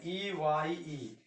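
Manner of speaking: ई वाई ई spelled very slowly, letter by letter; slow spelling. Shown as a demonstration. Teacher spells eye very slowly for the class. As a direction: drag out ई वाई ई one letter at a time. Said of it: "इसको हम लोग क्या पढ़ते हैं?".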